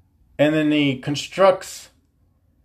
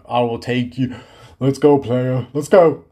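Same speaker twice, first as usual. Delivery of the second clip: daring challenge. Real, not acted. Comedian daring dermatologists to debate him, cocky. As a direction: dare them to come at you.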